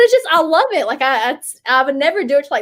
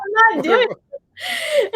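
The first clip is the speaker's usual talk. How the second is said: laughing refusal; 10 semitones higher, much slower than usual. Real, not acted.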